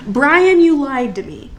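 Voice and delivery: loud, high yell of betrayal; screaming accusation. Retold or imitated. Boy screaming at Brian over cards. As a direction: scream it at the cheating kid. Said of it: "he screams".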